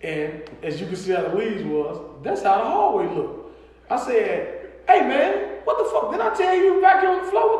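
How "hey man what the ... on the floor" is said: high-pitched exasperated dad telling-off; retold scolding. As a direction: act out scolding a kid, pitched way up. Retold scolding about the vacuum.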